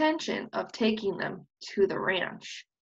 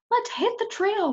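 eager bark; 11 semitones higher than usual. Acted. Prairie dog eagerly agrees to run. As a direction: bark it out, ready to go. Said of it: "barked Jeffrey".

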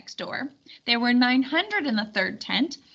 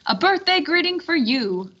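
higher, louder ceremonial call; grand announcement; 6 semitones higher, 4 dB louder than usual. Acted. Circumference signalling the hidden guests, grand raised call. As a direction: give the signal with flourish.

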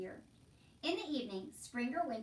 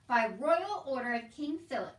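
loud, declaring royal officer; officious soldier; 9 dB louder than usual. Acted. Soldier reading out a royal order to Uncle, officious. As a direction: proclaim it as the king's order.

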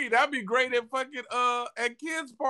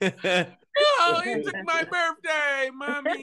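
put-on crying kid; 6 semitones higher, much slower than usual.

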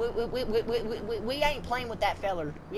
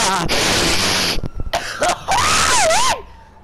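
loud, high, choking cough-yell; coughing fit after a harsh hit; 10 dB louder than usual. Real, not acted. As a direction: hack and shout as the smoke hits you. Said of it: "That shot me right in the face".

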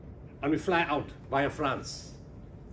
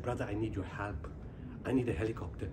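much lower and softer, begging a favour; quiet plea; 7 semitones lower, 9 dB quieter than usual. Retold or imitated. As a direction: ask humbly, almost under the breath. Pleading for a helicopter.

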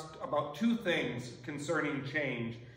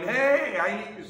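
much higher, louder call for attention; yelling; 5 semitones higher, 6 dB louder, slower than usual. Retold or imitated. Mother calls to Jesus over crowd, yelling. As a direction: shout over the crowd to be heard. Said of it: "She's yelling".

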